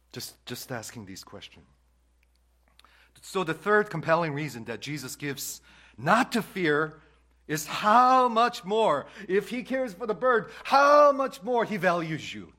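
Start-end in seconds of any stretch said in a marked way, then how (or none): 3.2-12.5 high, loud, slow preacher's climax; full-force preaching. Real, not acted. Pastor urging the congregation about God's care, soaring preaching voice. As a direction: lift the room with how much more.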